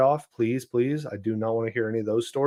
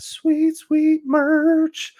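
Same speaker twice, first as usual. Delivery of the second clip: much higher, slow and drawn out; sing-song hype for the merch; hyped merch tease; 4 dB louder, much slower than usual. Real, not acted. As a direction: stretch it out like a big reveal. Hyping merch to listeners.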